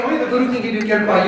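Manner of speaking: ordinary talk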